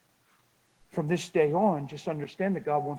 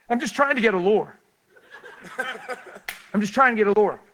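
loud, high mock protest; comic protest; 7 semitones higher, 9 dB louder, faster than usual. Preacher telling crowd his small errand, comic protest. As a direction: plead it loudly for laughs.

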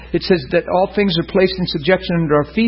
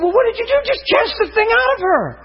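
high-pitched retold exasperated advice. Preacher retelling his advice to the caller, exasperated. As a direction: act out incredulous insistence on the phone.

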